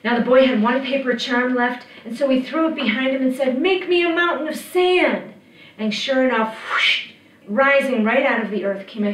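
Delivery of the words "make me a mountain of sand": high, raised magic command; boy's commanding cry, pitched up; urgent command. A narrator's reading. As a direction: call out the spell urgently. Boy commands the charm.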